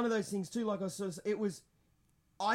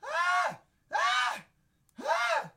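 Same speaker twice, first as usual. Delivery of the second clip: mock high squeals, much higher; hammed-up scared squealing; three short hammy shrieks; comical squeals; 3 dB louder, much slower than usual. Shown as a demonstration. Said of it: "I'll be a bit comical and be like".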